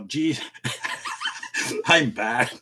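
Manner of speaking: ordinary talk, nothing marked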